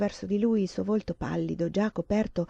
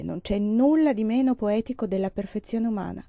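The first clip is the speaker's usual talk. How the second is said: ordinary delivery, nothing marked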